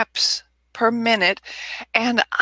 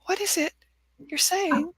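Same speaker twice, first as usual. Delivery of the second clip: high, soft confused puppy; mock puppy-dog bewilderment; 8 semitones higher, 5 dB quieter than usual. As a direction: ask it like a baffled puppy. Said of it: "the puppy dog going".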